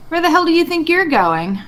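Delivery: high, loud threatening challenge; in-character shout. Acted. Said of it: "I think Gogo would, uh, just say".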